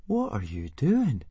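slow, high, puzzled question; curious. A narrator's reading. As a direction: ask it drawn out, full of curiosity. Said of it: "asked Fishlegs curiously".

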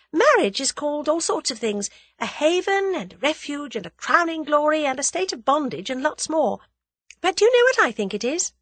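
high, playful build-up; lively heroine, pitched well up; spirited young heroine. A narrator's reading. Voices heroine teasing her fiancé.

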